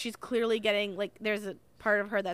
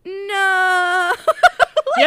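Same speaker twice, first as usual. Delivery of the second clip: a hammed-up crying "No.", high and loud; mock wailing; 8 semitones higher, 15 dB louder than usual. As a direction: wail "No." like a melodramatic heroine. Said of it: "and then she starts crying".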